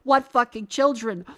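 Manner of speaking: loud, exasperated shout; real ranting shout; angry outburst. Real, not acted. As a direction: blow up at the absurdity. Ranting at the age rules.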